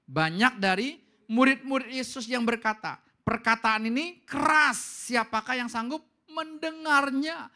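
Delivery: loud, slow group reading; reading aloud loudly. Shown as a demonstration. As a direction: read it out strong and deliberate. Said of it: "Dengan keras".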